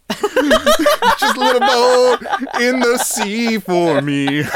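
slow, high, giggling chant; laughing sing-song. Acted. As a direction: stretch it out laughing.

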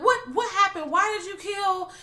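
mock high panicked questioning. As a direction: ask it like a panicked victim. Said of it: "The clown was like".

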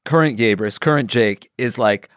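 normal talking voice

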